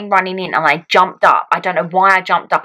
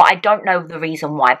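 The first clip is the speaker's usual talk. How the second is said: normal talking voice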